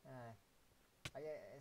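ordinary delivery

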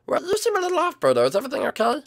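squeaky high-pitched friend voice; high character voice. Acted. Girlfriend answering brightly about dinner, high and chirpy.

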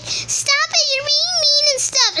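high-pitched mock fangirl whine. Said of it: "fangirls who are like".

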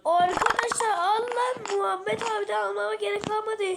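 high, loud excited outburst; excited shout. Real, not acted. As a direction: yell with excitement.

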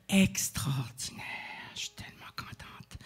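a hushed whisper of gratitude. Real, not acted. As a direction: confide it softly like a secret. Tender confiding about the poet.

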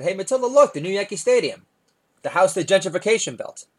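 bright parent calling attention; excited dad pointing. Retold or imitated. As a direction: show off the stadium to your kid.

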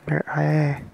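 ordinary delivery

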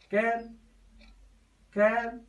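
"calculate" restarted on cal, then cu and late, each set apart by long pauses; syllable division. Shown as a demonstration. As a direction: demonstrate the syllables of "calculate" one by one. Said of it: "Syllable division".

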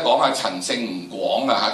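ordinary delivery

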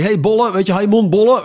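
cheeky heckle at concrete workers, called out high; brutal heckling. Retold or imitated. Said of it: "zo brutaal zijn".

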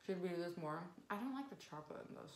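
talking while chewing pastry; speaking between bites; mouth full, chewing. Real, not acted. Seen 3: Left woman holds pastry at mouth, jaw working while talking.

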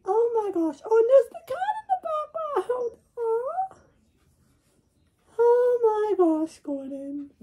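squeaky high character voice; high cartoon voice. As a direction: pitch way up, cartoonish.

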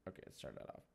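whispered, no voice; hushed so nobody wakes. Real, not acted. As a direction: breathe it out in a whisper. Quiet late-night game scene.

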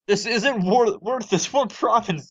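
high exasperated complaint. Real, not acted. Arguing with AI.

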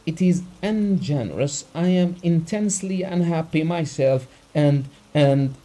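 hurt protest. A narrator's reading. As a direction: plead and bristle at once. Abogin protesting his grief.

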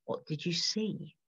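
a creature pressing its prey, slow and hushed. A narrator's reading. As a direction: coax the answer out like a predator, quiet and slow. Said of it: "the creature said".

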